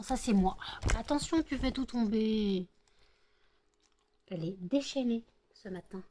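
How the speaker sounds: ordinary talk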